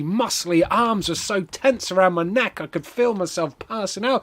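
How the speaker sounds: normal talking voice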